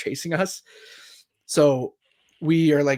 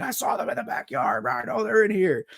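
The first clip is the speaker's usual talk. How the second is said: imitated screaming; 8 semitones higher than usual. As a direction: mimic a ranting man screaming from afar. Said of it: "he's screaming at them".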